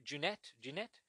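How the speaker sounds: soft, questioning French accent. Shown as a demonstration. Podcaster guessing at the director's name, in a French accent. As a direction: try the surname in French, softly, tentatively rising. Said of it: "a French accent".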